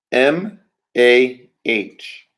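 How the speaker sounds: M A H, each letter named slowly and apart; spelling it out slowly. Shown as a demonstration. Teacher spelling a word letter by letter for students. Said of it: "let me do it for you".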